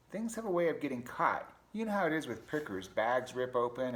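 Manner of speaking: high, loud animated complaint; exasperated pack rat. Acted. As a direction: rant as a fed-up storybook rat. Rat grumbles in story.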